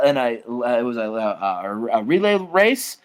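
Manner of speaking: ordinary talk, nothing marked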